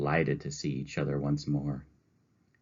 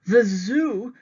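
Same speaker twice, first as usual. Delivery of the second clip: much higher, louder dismayed cry; miserable exclamation; 8 dB louder than usual. Acted. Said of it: "exclaimed miserably".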